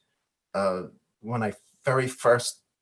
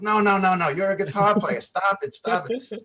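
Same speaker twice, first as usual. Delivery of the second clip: higher, insistent mock protest; a hammed-up friend saying no; protesting bandmate; 6 semitones higher than usual. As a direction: play the friend who won't accept it. Bandmate objecting, retold quote.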